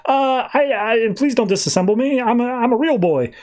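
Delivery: high, frightened robot begging for mercy; mock panicked pleading. Robot begging the Machine Queen not to disassemble him, high panicked plea. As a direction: plead like a terrified little robot. Robot begs for his life.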